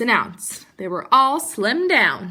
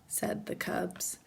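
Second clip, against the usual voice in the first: hushed narration; 4 semitones lower, 13 dB quieter, slower than usual. A narrator's reading. Narrator hushes before inside body.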